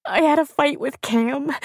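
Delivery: high tearful wail; words caught in a sob. A narrator's reading. Crying woman confesses fight to friends, sobbing. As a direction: choke it out tearfully. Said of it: "her words caught in a sob".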